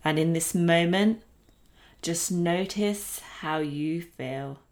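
slow calm breathing guidance; guided meditation. Acted. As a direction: lead the breath gently, unhurried.

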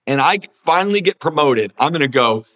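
normal talking voice

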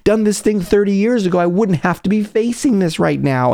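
high, whining self-pity; mock whiny complaint. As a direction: whine about the unfair task. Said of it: "a huge drama about fighting the discomfort".

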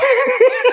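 a short high burst of laughter. Real, not acted. Joking about crying over the Masters, high laugh. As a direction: let out a quick high laugh.

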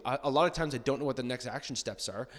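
ordinary talk, nothing marked

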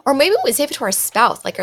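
usual speaking voice

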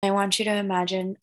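calm guided breathing voice. Acted. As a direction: gently lead the breathing. Leads guided meditation visualization.

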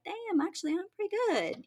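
high, delighted surprise; pleased discovery of own work; pleasantly surprised. Retold or imitated. Imagined pleased look at old photo.

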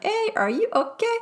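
high, light imitation of husband asking hey are you okay; husband's concern. Husband checking on his anxious wife, high mock imitation. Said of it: "he kinda looked at me like".

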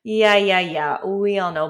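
loud, brushing-off yeah yeah yeah; mock dismissive. Viewer doubting affirmations, loudly dismissive. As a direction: wave it away like you've heard it all. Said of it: "if you're feeling like".

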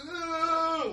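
high, loud wail of panic; hammed-up anxious scream; panicked groan. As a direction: let out an out-of-control cry. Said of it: "that panic or the worry".

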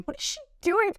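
high, incredulous mock gasp of a question; onlookers' disbelief. Guests at the buffet stare at her, high mock disbelief. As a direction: play the staring guests, shocked and judging. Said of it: "everyone's eyes were across to me and going".

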